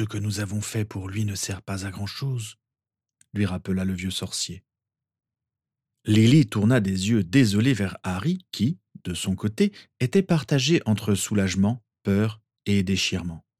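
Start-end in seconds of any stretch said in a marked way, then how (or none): none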